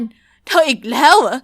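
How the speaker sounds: slow, loud words forced through clenched teeth; seething, each word ground out slowly; through gritted teeth. A narrator's reading. Mr Lincoln to the girl who wrecked his car, through gritted teeth. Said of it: "พูดลอดไรฟัน".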